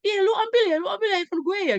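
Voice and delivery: loud, very high-pitched mock accusation; acted accusing yell. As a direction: imitate a student yelling to blame a classmate. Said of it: "Pada teriak mereka".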